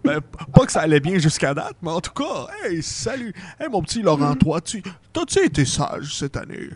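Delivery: lightly put-on, lilting Santa; recover, then quiz a child playfully; Santa Claus regaining composure. Santa questions Laurent.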